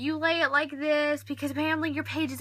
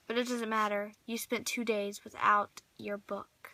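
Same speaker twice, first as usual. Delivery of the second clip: soft whisper; 4 semitones lower, 6 dB quieter than usual. Acted. Lamenting the lent book.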